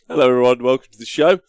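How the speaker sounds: bright, loud show host opener; presenter intro. Acted. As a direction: welcome them like a TV host.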